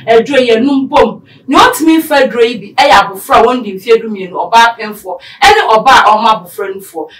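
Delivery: loud, upbeat sponsor read with punch. Acted. Host reads a sponsor ad to listeners, loud and upbeat. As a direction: sell the capsules like a radio ad.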